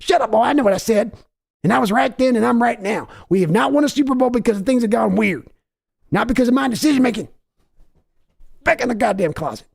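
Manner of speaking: high, barking bully dad scolding his son; Jerry Jones berating his son; angry father. Acted. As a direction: tear into the son.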